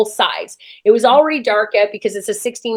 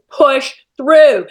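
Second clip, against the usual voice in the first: louder, forceful imitated coaching push; retold urging; 2 semitones higher, 5 dB louder than usual. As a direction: urge a flagging runner on. Imitates announcer urging her on.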